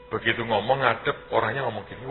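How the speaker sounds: ordinary talk, nothing marked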